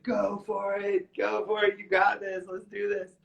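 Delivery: high, excited cheering. Retold or imitated. Man in a dream cheering on the carving hands, high and excited. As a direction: egg on the carving hands like a fan. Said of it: "cheer 'em on".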